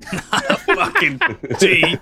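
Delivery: laughing. Real, not acted. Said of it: "Are you drinking?".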